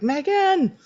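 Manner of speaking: high-pitched mock calling out; searching call. Felicity looking for absent Megan, searching call. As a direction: call the name into another room.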